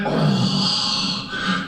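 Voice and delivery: a deep, hushed bear sigh; bear groan. Acted. Storyteller voicing Brother Bear looking down, low bear groan. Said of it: "and he went".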